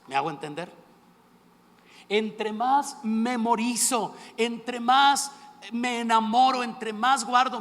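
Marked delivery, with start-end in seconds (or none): 2.1-7.6 predicación enfática. Acted. Clímax de predicación, lento.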